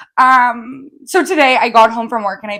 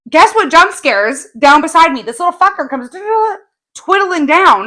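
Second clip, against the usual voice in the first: higher, louder storytelling; excited; 7 semitones higher, 4 dB louder than usual. Real, not acted. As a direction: tell the scare with rising energy. Retelling a spider scare.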